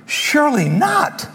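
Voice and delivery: high, slow surely not; disbelieving protest; stunned refusal. Retold or imitated. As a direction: draw it out in shock. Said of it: "This is mind-blowing to Peter".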